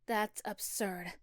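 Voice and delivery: usual speaking voice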